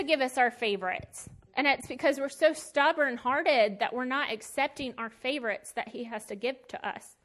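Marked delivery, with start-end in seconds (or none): none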